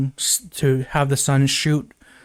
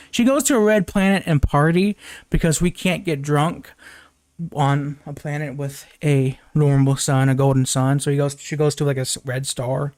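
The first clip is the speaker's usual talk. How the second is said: usual speaking voice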